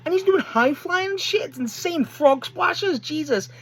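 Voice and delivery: high, astonished fan raving; amazed gushing. Real, not acted. Fan tells viewers about a huge wrestler, amazed gushing. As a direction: gush in high-pitched disbelief.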